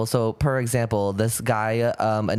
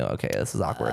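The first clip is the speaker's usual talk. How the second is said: low deadpan; 14 semitones lower, 5 dB quieter than usual. Retold or imitated. Retold inner thought on bad date.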